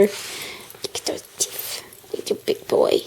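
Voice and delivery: soft whisper to the dog. Real, not acted.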